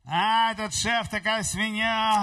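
slow, scornful grumble about the boss; lightly voiced disdainful complainer; grumbling complaint. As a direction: mimic a man badmouthing his boss, drawn out. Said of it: "и он ругается".

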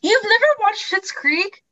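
high-pitched, incredulous question; shocked and offended. Real, not acted. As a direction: ask it in offended disbelief. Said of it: "I am shocked and offended".

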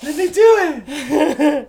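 put-on high, loud, drawn-out childish character voice; Stewart. Co-host doing a character for laughs, squeaky childish voice. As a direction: squeal it very slowly through giggles. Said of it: "that's your Stewart voice".

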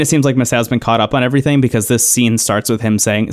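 normal talking voice

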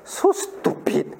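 whispered so stupid; scornful whisper. Retold or imitated. Classmate scoffing at another child, whispered scorn. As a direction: breathe it out with contempt. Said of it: "cross your eye means what".

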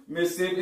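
"Mississippi" squeezed into barely three syllables; local pronunciation. Shown as a demonstration. Explaining the two syllables, short local "Mississippi". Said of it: "you got two goddamn syllables".

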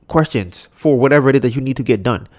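normal talking voice

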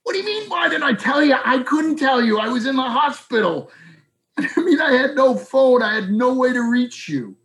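high, fast exasperated retort; incredulous. Retold or imitated. Ed answering his old friend, high and exasperated. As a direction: throw it back in disbelief. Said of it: "I'm like".

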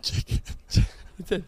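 CH in "Chicken" made with the tongue too far forward; thick-tongued, airy "Chicken"; lisped chicken. Shown as a demonstration. Lisper demonstrates a CH to a doubting friend, lisped. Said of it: "My tongue gets too involved".

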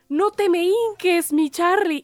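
high, drawn-out mock plea; imitating a flustered "oh, please don't"; retold flustered plea. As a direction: plead slowly, pitched well up.

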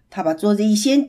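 ordinary delivery, nothing marked

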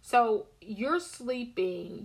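usual speaking voice

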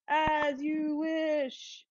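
slow, drawn-out as you wish; tender as you wish. Retold or imitated. Reciting the love line, slow and tender. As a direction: linger on it like a devoted farmhand.

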